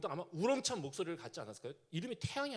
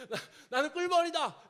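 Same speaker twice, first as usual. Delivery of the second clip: laughing; 8 semitones higher, 6 dB louder than usual. Acted. Preacher laughing at his own slip.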